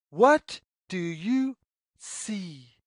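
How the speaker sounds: ordinary delivery, nothing marked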